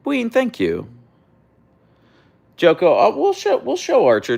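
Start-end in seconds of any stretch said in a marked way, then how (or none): 2.6-4.4 high and louder; excited. Real, not acted. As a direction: pitch up, eager to please. Excited reply to chat.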